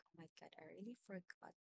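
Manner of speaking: usual speaking voice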